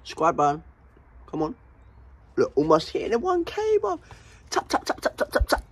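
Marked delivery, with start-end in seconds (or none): 4.5-5.6 unvoiced, quick whisper of each tap; whispered urging to tap; whispered tapping. Real, not acted. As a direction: whisper the taps like a cue. Urging subscribe taps.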